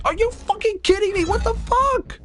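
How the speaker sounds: real rage at the screen; furious outburst. Real, not acted. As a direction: blow up at the game again. Third rejection, raging.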